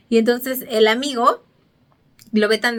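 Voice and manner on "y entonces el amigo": usual speaking voice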